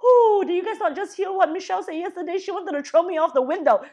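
high and loud mock exclamation; playful imitation of the President; joking President quote. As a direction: toss off the joke with a big whoop. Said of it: "And he was like".

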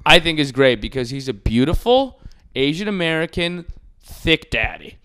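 usual speaking voice

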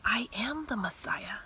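whispered, soft and solemn; Jesus revealing himself to the woman, whispered; hushed reveal. Acted.

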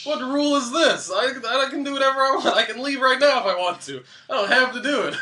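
indignant, loud and high; hammed-up refusal to obey; defiant protest. Cramped runner argues with coach, defiant.

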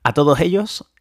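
fired-up mini rant against closed minds; getting carried away. Real, not acted. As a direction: let yourself get worked up about it. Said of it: "me he venido arriba con el tema".

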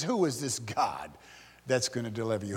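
low, soft, dismissive question; sarcastic scorn. Acted. Arrogant king doubting their God, quietly sarcastic. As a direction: drop pitch and volume to belittle. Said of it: "He's sarcastic".

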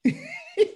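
very high-pitched laugh; helpless giggle. Real, not acted. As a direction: crack up in a high squeal. Laughing at the nickname. (risita muy aguda)

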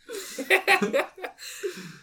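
high-pitched burst of laughter. Real, not acted. Host cracking up over the word of the week, high laugh.

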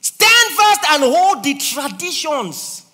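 very loud, high, slow proclamation; preaching at full force; proclaiming shout. Real, not acted. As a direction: thunder it out slowly.

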